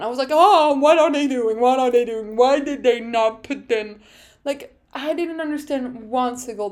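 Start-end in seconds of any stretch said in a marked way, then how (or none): 0.3-4.0 loud, panicked reliving of the moment; anxious outburst. Retold or imitated. Anxious fan reliving Ferrari's tire strategy in Q one, panicked outburst. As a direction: blurt it out loudly in alarm. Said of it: "all my body was shaking while watching".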